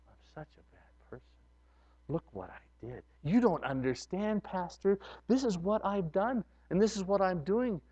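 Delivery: hushed, mostly breathless voice of a guilty believer; a hushed guilty confession; quiet self-blame. Retold or imitated. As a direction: voice the congregant's self-reproach softly. Congregant's inner self-blame voiced.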